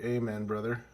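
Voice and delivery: "Amen" said as AY-men; ay-men pronunciation. Shown as a demonstration. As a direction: start "Amen" like the letter A.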